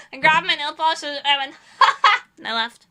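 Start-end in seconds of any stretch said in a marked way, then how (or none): 1.8-2.2 loud, high put-on laugh; mock laugh. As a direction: laugh it off in their face. Repeats her laugh-off reply.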